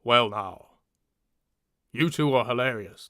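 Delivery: low northern voice, not posh; lightly voiced deep northerner; Richard Armitage. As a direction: attempt a low, gallant northerner. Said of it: "he has a very low voice".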